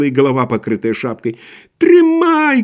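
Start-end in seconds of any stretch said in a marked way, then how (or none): 1.8-2.6 very high, loud answering cry; thin tenor shout. A narrator's reading. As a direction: yell back in a thin tenor. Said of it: "кричал в ответ первому второй тонкий тенор". (крик тонким тенором)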